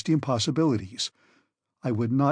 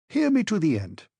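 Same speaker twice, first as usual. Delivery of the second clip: firm voice; 5 semitones higher than usual. A narrator's reading. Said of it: "the professor went on with a firm voice".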